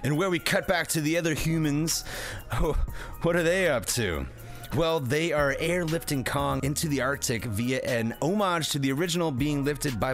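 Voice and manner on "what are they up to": normal talking voice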